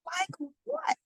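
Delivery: breathy whisper. A narrator's reading. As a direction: ask it under your breath. Brenda asks.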